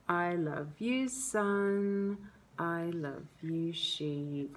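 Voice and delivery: slow, soft singsong reading; gentle lullaby. Shown as a demonstration. Mother demonstrating how she reads the picture book aloud, gentle lullaby. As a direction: lull gently, stretching each word out. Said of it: "always read this with the same intonation".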